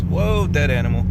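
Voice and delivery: sudden startled whoa; surprised. Real, not acted. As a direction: caught off guard mid-drive. Sudden sight while driving.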